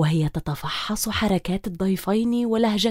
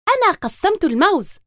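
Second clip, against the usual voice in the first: higher, pleased boast; proud; 6 semitones higher than usual. A narrator's reading. Girl tells guests she cut the bananas, proud. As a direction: show off what she did. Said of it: "قالت تمارا بفخر".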